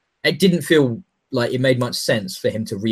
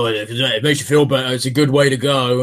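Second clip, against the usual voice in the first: the Hound; 2 semitones lower than usual. Retold or imitated. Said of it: "and then with the Hound go".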